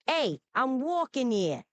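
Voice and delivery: punchy Brooklyn-accented catchphrase. Shown as a demonstration. Assistant repeating the user's line, Brooklyn accent. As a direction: play the classic Brooklyn local. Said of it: "Classic Brooklyn vibe".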